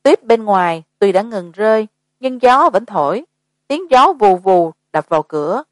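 ordinary delivery

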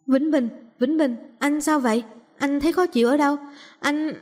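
worried woman. A narrator's reading. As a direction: voice a woman checking on someone collapsing. Woman checks on collapsing man.

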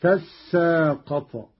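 تساقط said slowly as "tassaaqat"; merged sin. Shown as a demonstration. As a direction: hold the doubled s in تساقط. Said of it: "وأدغمت في السين فصارت هكذا".

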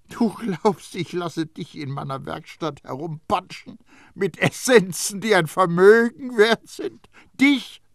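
high, loud accusing tirade; snapping at him. A narrator's reading. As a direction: call the little cheat out. Baldini scoffing.

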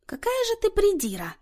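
high-pitched indignant exclamation. A narrator's reading. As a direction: scold a fussy friend, pitch jumping up. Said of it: "возмутилась Люся".

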